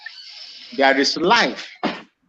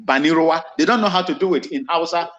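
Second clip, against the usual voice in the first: ordinary talk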